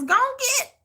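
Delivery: high Texan mock "Go and get"; Texas drawl taunt. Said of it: "Like they say in Texas".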